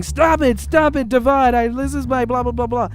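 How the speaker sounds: high-pitched mock yell; imitated yelling. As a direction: mimic a woman crying out for it to stop. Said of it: "she stands up yelling".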